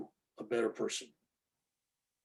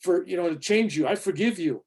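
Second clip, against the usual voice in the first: higher, louder mock saintly forgiveness; pious do-gooder; 5 semitones higher, 8 dB louder than usual. Mocking friends who forgive people aloud, he goes higher and louder. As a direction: lay the holy forgiveness on thick. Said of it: "And they go up to the person".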